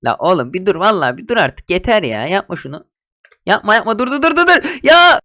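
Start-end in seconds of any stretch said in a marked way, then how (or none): 3.5-5.2 frantic shout to stop; panicked yell. Real, not acted. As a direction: yell at him to quit. Pleading friend to stop.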